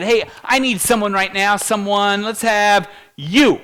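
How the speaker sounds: hey you, call him up; calling out from the stage. Retold or imitated. Speaker on stage picks a volunteer, loud call.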